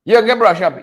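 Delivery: ordinary delivery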